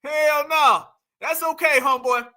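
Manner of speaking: ordinary talk, nothing marked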